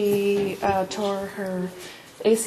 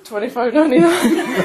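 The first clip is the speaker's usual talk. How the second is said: laughing guess; 3 semitones higher, 8 dB louder than usual. Real, not acted. Guessing the exact bill, delighted.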